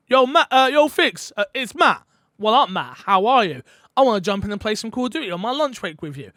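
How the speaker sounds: loud, high, eager caller hailing a friend; mock an upbeat gamer dropping in; hammed-up chirpy pal; questionable mate impression. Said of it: "These impressions are questionable".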